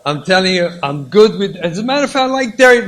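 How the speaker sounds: both spouses outdoing each other to give in; insistent. Acted. Husband and wife each insisting on the other's choice, loud and eager. Acted loving argument.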